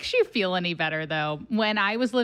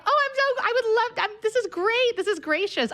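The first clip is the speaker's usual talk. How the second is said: gushing newscaster; 13 semitones higher than usual. Retold or imitated. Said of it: "And they're like".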